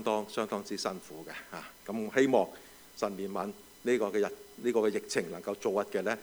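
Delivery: hushed, earnest prayer; quiet prayer. Acted. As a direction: pray softly for relief. Prays for the epidemic.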